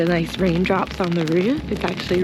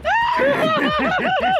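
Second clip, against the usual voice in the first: high shrieking laugh; squealing laugh. Real, not acted. Sheep eats from her hand.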